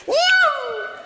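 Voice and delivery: high, whizzing "ñau"; quick squealed sound effect; imitated camera zap. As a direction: make a high-pitched camera flash noise. Imitates traffic camera flash.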